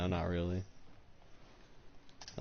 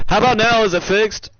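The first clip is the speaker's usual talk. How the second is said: much louder, higher call; loud check; 11 semitones higher, 14 dB louder than usual. Real, not acted. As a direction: project to check the mic. Checking fixed audio, loud.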